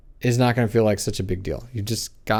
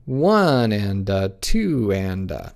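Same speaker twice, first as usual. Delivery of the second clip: slow, long ONE and TWO with light "and uh"; counting in two; 3 semitones lower, much slower than usual. Shown as a demonstration. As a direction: stretch the main beats, lilting between them. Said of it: "Or do you count".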